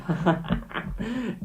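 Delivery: a short run of real laughter; amused laugh. Real, not acted. Streamer agrees "you and I both", laughing.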